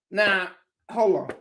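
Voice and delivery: mock preacher building up; preaching. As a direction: hold the congregation then boom. Mock sermon continues.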